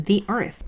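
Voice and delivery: "the earth" said "dee earth". Shown as a demonstration. Teacher models "the earth" for learners, pronounced "dee earth". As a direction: give "the" an "ee" vowel before "earth". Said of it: "이렇게 읽으시면 됩니다".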